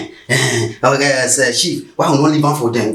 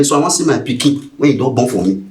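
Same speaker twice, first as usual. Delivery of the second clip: usual speaking voice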